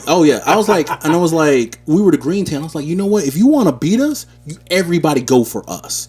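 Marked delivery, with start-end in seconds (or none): 2.9-6.1 higher and a bit louder boastful challenge; retold gamer trash talk; cocky promo. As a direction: dare the whole lobby to come at you. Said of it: "You were cutting promos on 'em?".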